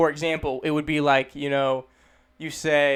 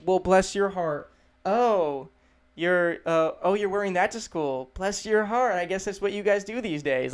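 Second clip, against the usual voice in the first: higher-pitched, passive-aggressive pity; judgmental elder; 4 semitones higher than usual. Acted. Judgmental elder on what you're wearing to school, condescending sweetness. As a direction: disguise the insult as kindness. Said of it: "elderly people who are particularly judgmental".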